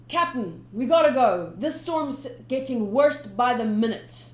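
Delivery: loud, urgent warning; urgent crewman. Acted. Crewman warning the captain of the storm, loud urgent. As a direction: hurry the captain along.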